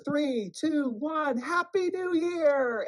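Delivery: high, loud drawn-out New Year cheer; loud festive countdown shout. Retold or imitated.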